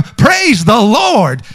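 drawn-out cheer imitating elated people; slow, stretched-out mock rejoicing; excited praise. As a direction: whoop it like a thrilled congregation. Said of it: "And people go".

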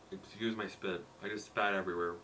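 muffled behind palm; covering mouth while eating; hand over mouth. Real, not acted. Seen 1: Hand cupped over mouth and nose 2.2–5.3s while eating cookie.

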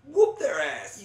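high, loud battle cheer; excited fight cry; rallying shout. Real, not acted. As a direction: egg your teammate on.

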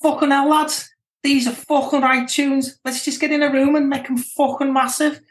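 a deliberately terrible Liverpool Scouse impression of the producer; bad Scouse accent. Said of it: "my worst attempt at a Scouse accent".

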